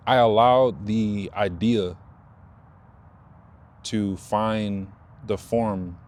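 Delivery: I allowed the idea to find the form, slow with weight on allowed; slow emphatic point. Real, not acted. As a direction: lean on allowed and stretch the phrase out. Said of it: "the point I was trying to make".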